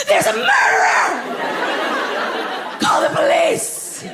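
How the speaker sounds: hammed-up panicked shout; mock the internet's overreaction; mock dramatic. Internet commenters overreacting, mock panicked shout. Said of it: "so fucking dramatic".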